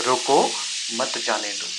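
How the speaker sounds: loud rising "रोको", break, "मत जाने दो" together; pause after रोको. Shown as a demonstration. Passer-by orders driver held, pausing after a loud रोको. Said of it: "लेकिन कहा कुछ ऐसे कि".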